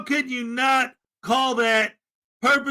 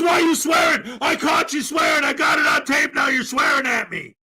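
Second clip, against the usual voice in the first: higher, louder indignant yelling; mocking accuser; 3 semitones higher, 3 dB louder than usual. Mock auditor yelling accusations at the officer on the phone. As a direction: parody him pouncing on the swearing. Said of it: "And he goes".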